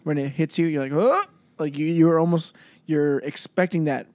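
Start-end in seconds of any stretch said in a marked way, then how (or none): 0.9-1.2 surprised oh. Retold or imitated. Tasting sour beer, reaction.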